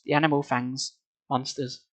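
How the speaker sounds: ordinary delivery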